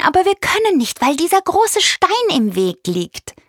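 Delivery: high-pitched eager bird voice; high, lively bird asking for help; chirpy bird, pleading. A narrator's reading. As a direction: plead as an earnest little bird.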